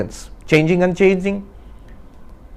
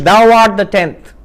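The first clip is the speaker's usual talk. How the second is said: slow, louder pronouncement; solemn wise man; 5 semitones higher, 6 dB louder than usual. Acted. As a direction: declare it like a sacred truth. Wise man reveals the tenth.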